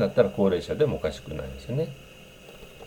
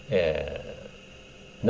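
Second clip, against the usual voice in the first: ordinary talk, nothing marked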